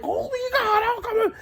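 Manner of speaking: very high, hammed-up panic; panicked outcry. Passenger under the triangle of lights, acting out high-pitched panic. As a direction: cry out high as if overwhelmed.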